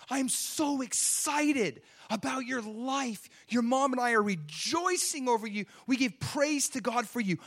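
put-on high-pitched, joyful proclaiming to the baby; elated dad addressing the belly; excited talk to unborn baby. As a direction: rejoice out loud over your unborn child. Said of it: "I just say things like this".

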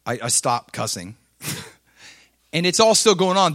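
ordinary talk, nothing marked

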